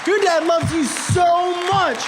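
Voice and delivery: high, slow, drawn-out exclamations; impassioned insistence. Retold or imitated. Friend convinces son that his dad loves him, impassioned insistence. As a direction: hammer it home, pitch soaring. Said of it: "He says".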